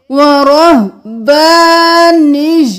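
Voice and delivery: ورہبانیہ drawn out slowly; slow recitation. Shown as a demonstration. Teacher recites the word people often misread. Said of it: "بہت سے لوگ غلطی کرتے ہیں".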